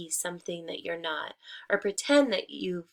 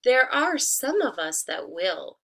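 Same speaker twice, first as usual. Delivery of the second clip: much higher, louder sly tree voice; 4 semitones higher, 6 dB louder than usual. Acted. Disguised wolf answering the hen, high and sly. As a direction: sing it out sweetly, hiding the wolf. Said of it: "said the tree".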